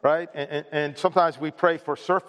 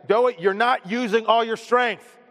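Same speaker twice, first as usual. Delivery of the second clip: stern dad; 6 semitones higher, 3 dB louder than usual. Retold or imitated. Dad pushes son harder.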